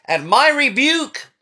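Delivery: rising rebuke. Acted. Preacher voicing God's rebuke.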